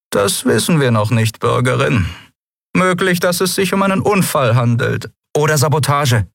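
high, formal peacekeeper voice; stiff official. A narrator's reading. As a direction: speak as a cool lawman. (höhere, förmliche Stimme eines Ordnungshüters)

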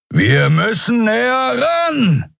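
high, drawn-out yell; shouting over wind. A narrator's reading. Kero urges the pilot closer, shouting over wind. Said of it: "rief Kero über den heulenden Fahrtwind".

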